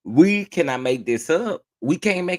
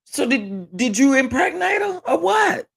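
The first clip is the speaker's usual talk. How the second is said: incredulous shout; 10 semitones higher than usual. Real, not acted. Host yelling at the caller in disbelief, shouted. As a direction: yell the question in disbelief.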